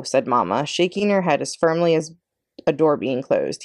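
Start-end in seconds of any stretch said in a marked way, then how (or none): none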